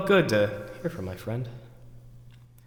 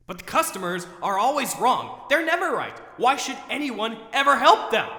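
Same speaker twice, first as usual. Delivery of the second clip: high-pitched rant building to a full shout; angry rant; 8 semitones higher, 5 dB louder than usual. Acted. Overworked employee raging about needy customers, rant rising to a shout. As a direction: start exasperated, climb to yelling.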